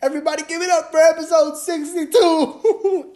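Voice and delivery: high cartoon hype man voice; squeaky hype voice. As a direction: squeal the call for applause.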